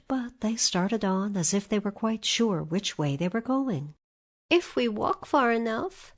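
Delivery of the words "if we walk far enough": put-on lightly raised little-girl voice; a hint of a hopeful young girl; Dorothy. A narrator's reading.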